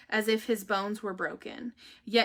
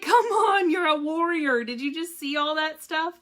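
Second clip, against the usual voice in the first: higher, louder pep talk; encouraging; 6 semitones higher, 5 dB louder than usual. Retold or imitated. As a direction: cheer up the eye-rolling husband. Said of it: "I'm like".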